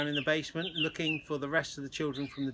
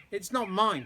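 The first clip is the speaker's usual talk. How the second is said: indignant protest, a touch louder; 6 semitones higher, 5 dB louder than usual. A narrator's reading. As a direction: protest it, offended. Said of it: "protested Tom".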